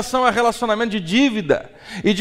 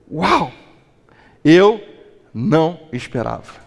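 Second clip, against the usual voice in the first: amazed gratitude; 5 semitones lower than usual. Shown as a demonstration. Preacher showing a spouse how to thank with wonder. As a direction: sound truly surprised and grateful. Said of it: "Eu sei que a minha entonação chamou isso".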